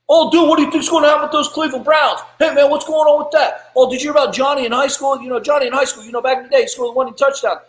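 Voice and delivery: lampoon the chatter of people going nowhere; mocking gossipy small-talk. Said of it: "They're talking about nothing".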